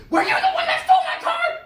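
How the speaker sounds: high, loud shrieking question; crazed accusation. Retold or imitated. Woman accusing the guy with her purse, high loud shriek. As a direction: shriek it before attacking.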